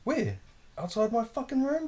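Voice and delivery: high, urgent alarmed call. Retold or imitated. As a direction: tell a mate the danger outside. Alarmed phone call about intruder.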